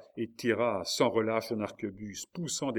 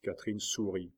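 ordinary delivery

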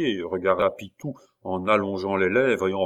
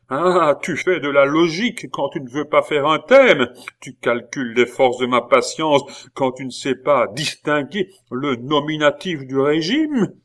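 louder, biting reproach; angry scolding; 5 semitones higher, 6 dB louder than usual. A narrator's reading. Abbé berating the arguing pupil. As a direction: lash out at him.

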